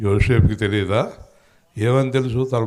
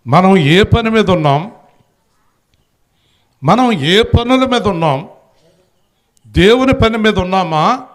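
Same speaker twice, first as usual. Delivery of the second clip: rousing questions; 9 semitones higher, 7 dB louder than usual. Acted. Rhetorical questions at congregation.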